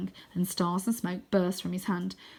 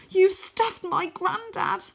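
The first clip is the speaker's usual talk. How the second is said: weak horrified cry; 12 semitones higher, 5 dB louder, much slower than usual. Acted. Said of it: "My voice is weak".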